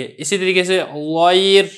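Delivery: गैर read slowly with a thin, light र; slow, careful model of गैर with a thin र. Shown as a demonstration. Teacher gives students examples of a thin र. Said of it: "रा को बारीक पढ़ा जाएगा".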